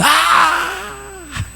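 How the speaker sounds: high, drawn-out wail; imitated crying child's yell; child's scream. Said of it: "Más gritó".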